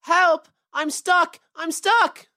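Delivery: high, urgent bunny calls; crying out for help. A narrator's reading. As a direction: call out as a trapped little rabbit. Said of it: "he cried".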